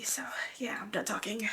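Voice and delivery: breathy whisper to close; whispered wrap-up. Real, not acted. Finishing her emotional tribute to her mom, whispered. Wrapping up after tearful tribute.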